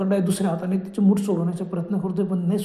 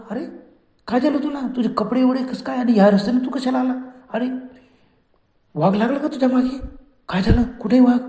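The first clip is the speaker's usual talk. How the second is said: put-on higher-pitched, excited young villagers' questions; acted astonishment, high and raised; alarmed questioning; 5 semitones higher, 4 dB louder than usual. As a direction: fire startled questions at the shaken boy. Village children question the shaken boy.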